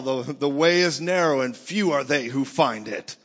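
loud, heavy scripture quote as a verdict; condemning religion. Acted. Religion quoting scripture against churchgoers, loud and condemning. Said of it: "religion tells you".

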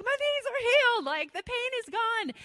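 excited. Retold or imitated. Healed man jumping up and down.

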